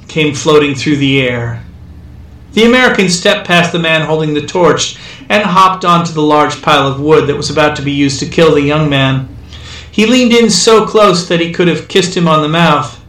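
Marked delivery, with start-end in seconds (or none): none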